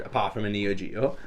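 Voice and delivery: ordinary talk, nothing marked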